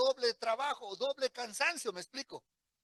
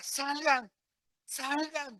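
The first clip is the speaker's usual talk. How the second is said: grito de ánimo; 4 semitones higher, 3 dB louder, much slower than usual. Retold or imitated. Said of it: "nos gritaba".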